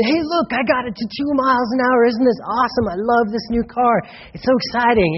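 high, bright-eyed eager chatter; mock-excited voice. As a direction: gush like a proud new owner. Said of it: "And you're all excited".

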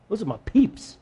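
a lightly put-on smug devil; preacher voicing Satan as pleased with the legalistic church. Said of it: "That's what Satan would say".

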